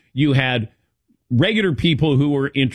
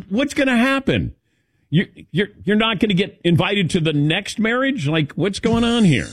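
higher, pleading mock outrage; a friend's mock indignation at wedding secrecy; 7 semitones higher than usual. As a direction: act baffled at the secrecy. Retold exasperated questions.

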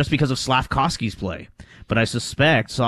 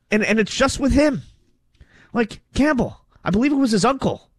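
pitched much higher, worked up and insistent; riled up; 10 semitones higher than usual. Real, not acted. Host arguing pronunciation with his cohost, riled up and high-pitched. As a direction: get genuinely riled over the point. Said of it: "I like it when you get riled up".

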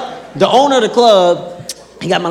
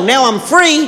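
normal talking voice

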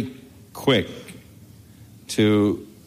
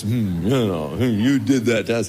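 huffy hummed complaint, lightly played; mock righteous indignation. Punished boys sulking at their dad, mock indignant huff. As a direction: sulk it out like a wronged kid. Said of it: "righteous indignation".

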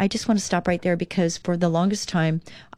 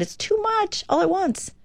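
overwhelmed; 13 semitones higher than usual. Real, not acted. Overwhelm builds, voice jumps.

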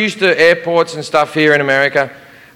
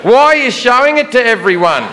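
higher, sarcastic Aussie guard; polite sarcasm; 8 semitones higher than usual. Retold or imitated. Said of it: "very politely but extraordinarily sarcastically".

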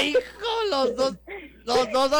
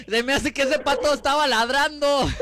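laughing; 4 semitones higher than usual. Real, not acted. Host laughs at failed duck.

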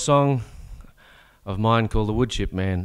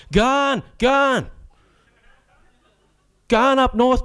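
much higher, louder mock scream; 4 dB louder, much slower than usual. McMahon rallying the navy, mock screaming. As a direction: yell it like a rallying cry. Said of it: "that cracker Jack McMahon was screaming".

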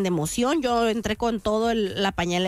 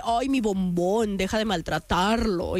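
slow, lower-pitched doting brother; Armando; 5 semitones lower than usual. Retold or imitated. Sister imitating brother defending the roomie, slow and doting. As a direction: coo it low and slow in mock protection. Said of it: "Mi hermano el Armando le dice".

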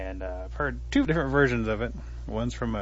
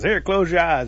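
raised, louder storyteller's call; 6 semitones higher, 8 dB louder than usual. Acted. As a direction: call instructions down from a tree. Possum calls down to Turtle.

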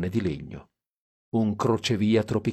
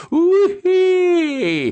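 very high loud whooping cry; 7 dB louder than usual. Acted. Dean whoops about the mambo, high and loud. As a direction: whoop with wild delight.

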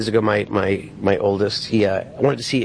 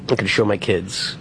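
ordinary talk, nothing marked

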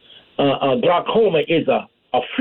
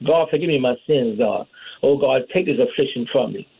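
low-pitched plea in prayer; praying; 6 semitones lower than usual. Acted. Sinner asking God for forgiveness, low pleading prayer. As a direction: drop low and petition God, as if praying privately. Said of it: "That's why we pray".

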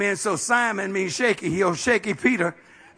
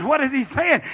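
ordinary delivery

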